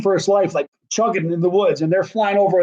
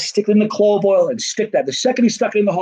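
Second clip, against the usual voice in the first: normal talking voice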